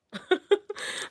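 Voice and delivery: bright burst of laughter; squealing laugh; high laugh. Real, not acted. Laugh after self-quote.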